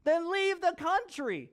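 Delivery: mock-furious official ordering them out; angry Haman. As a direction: bark it at the ones who won't bow. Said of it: "Haman gets angry, and he says".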